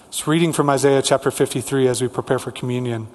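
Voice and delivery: ordinary delivery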